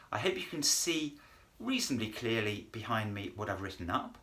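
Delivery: ordinary talk, nothing marked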